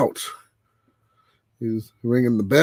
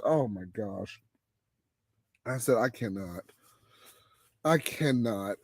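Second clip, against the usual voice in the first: much softer and lower, overwhelmed; disbelief; 3 semitones lower, 9 dB quieter than usual. Retold or imitated. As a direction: breathe it out like you can't take it.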